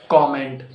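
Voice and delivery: "comment" as KOM-ent; corrected COMMENT. Shown as a demonstration. Teacher correcting learners, "comment" said as KOM-ent. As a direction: say "comment" with open O up front. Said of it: "It's not comment".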